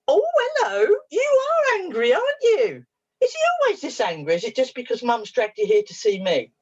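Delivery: very high, playful greeting; talking to a puppet. Retold or imitated. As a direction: coo in surprise at a tiny imaginary creature. Talks to imagined part in hand.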